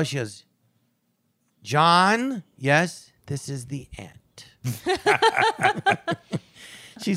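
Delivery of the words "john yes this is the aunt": slow caricature of an aunt, dropping to a whisper; Aunt Sandy. Retold or imitated. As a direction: draw out the name, then sink to a breath. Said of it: "my Aunt Sandy, she goes like".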